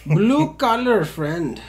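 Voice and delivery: put-on Indian accent. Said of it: "I don't know why I sound Indian".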